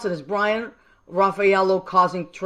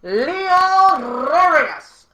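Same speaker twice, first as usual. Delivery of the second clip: slow, drawn-out, much higher and louder, rolling the R in Rodriguez; yell with rolled R; 9 semitones higher, 8 dB louder, much slower than usual. Shown as a demonstration. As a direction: call it out to everyone at home and roll the R. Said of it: "And roll that R if you can".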